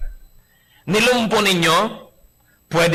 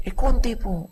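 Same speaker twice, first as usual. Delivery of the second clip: meek wife; 6 semitones higher than usual. Retold or imitated. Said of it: "O baka naman, sumasagot ka".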